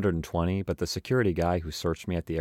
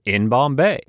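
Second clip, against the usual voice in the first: higher and louder, goofy; kid's punchline; 6 semitones higher, 9 dB louder, slower than usual. Retold or imitated. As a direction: hit it like a schoolyard gag. Second-grade punchline acted.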